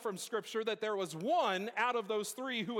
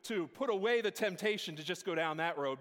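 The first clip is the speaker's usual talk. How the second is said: ordinary delivery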